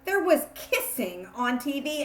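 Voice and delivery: plain reading voice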